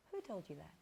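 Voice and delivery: ordinary delivery, nothing marked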